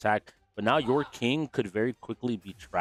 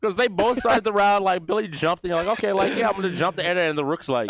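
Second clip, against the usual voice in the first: excited; 9 semitones higher, 6 dB louder than usual. Real, not acted. Excited retelling of round.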